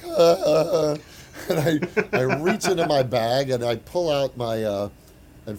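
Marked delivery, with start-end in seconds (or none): 0.0-1.0 high loud flustered ah ah ah; stammer, overwhelmed by your hero; starstruck stammer. Retold or imitated. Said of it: "and I'm just like".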